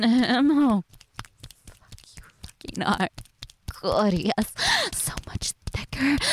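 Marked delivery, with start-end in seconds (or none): 1.7-3.1 soft, breathy whisper; hushed aroused whisper. Acted. Aroused aside in sex roleplay. 4.9-6.2 soft, breathy whisper; hushed aroused whisper. Acted. Aroused praise in sex roleplay.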